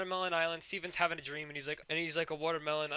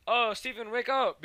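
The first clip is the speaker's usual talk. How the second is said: higher, louder call to a sleeper; mock urgent call; 9 semitones higher, 7 dB louder than usual. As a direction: imitate calling someone awake. Voices gems waking Steven.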